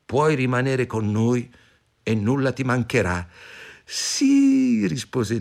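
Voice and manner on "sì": high, drawn-out delighted yes; bright-eyed girl saying yes; eager acceptance. A narrator's reading. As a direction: leap at the offer in a high voice. Heroine eagerly accepts the gnomes' offer.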